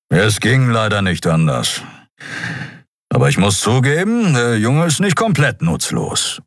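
lightly voiced grudging grumbler; a hint of gruff creature; grumpy beast mechanic. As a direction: admit it grudgingly, in a mild growly character voice.

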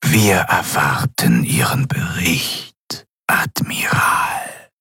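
very slow, whispery voice of authority; imperious governor. A narrator's reading. Governor awaiting the admiral's report, slow imperious whisper. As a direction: draw each word out, sinking into a whisper.

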